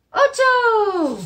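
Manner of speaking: high, loud cheer; excited answer. Acted. Teacher cheering the right flashcard answer.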